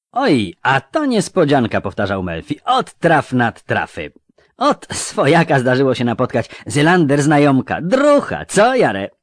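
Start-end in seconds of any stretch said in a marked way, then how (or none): 0.1-1.8 high, loud, surprised youth; young man's voice. A narrator's reading. Young robber marvelling at meeting a schoolmate, high and loud. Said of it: "młodym i dziwnie znajomym głosem". 2.6-9.1 loud, high, chummy rustic youth; young man's voice. A narrator's reading. Young robber greeting his old schoolmate, loud and high. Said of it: "młodym i dziwnie znajomym głosem".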